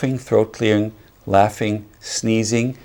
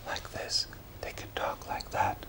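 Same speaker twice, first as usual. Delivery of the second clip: very soft, voiceless whisper; barely audible, all air and no tone; 17 dB quieter than usual. Shown as a demonstration. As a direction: whisper gently to demonstrate. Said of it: "they can whisper like this".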